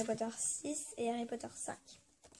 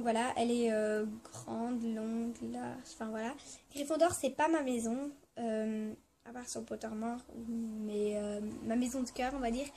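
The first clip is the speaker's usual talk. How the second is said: usual speaking voice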